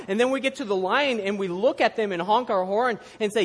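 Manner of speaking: loud, high, animated sermon delivery; energetic preaching. Acted. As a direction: preach it out with a laugh in the voice.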